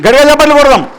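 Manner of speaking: loud, high announcement to the crowd; calling for applause. Real, not acted. Speaker announces a volunteer's pledge to the audience, rallying loudly.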